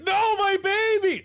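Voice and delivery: high, loud hammed-up cry of grief; overacted wail. Mother screaming over her dead baby, hammed-up wail. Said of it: "I'm overacting this way too much".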